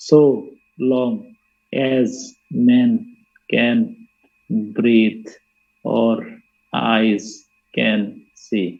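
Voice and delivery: one word at a time, long pause after each; slow, even counting-out of each word; syllable by syllable. Shown as a demonstration. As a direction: read it as ten separate beats. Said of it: "দশটা, গুণা দেখো দশটা".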